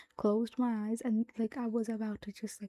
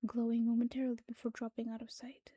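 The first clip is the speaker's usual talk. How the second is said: whispered story reading. Acted. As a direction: read the story in a hushed whisper.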